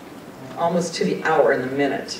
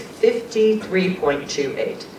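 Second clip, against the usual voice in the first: slow, higher, measured dictation of FIFTY-THREE POINT TWO EIGHT; 4 semitones higher, slower than usual. Retold or imitated. Friend dictating a message to be written, slow dictation. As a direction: spell out each number slowly for writing down.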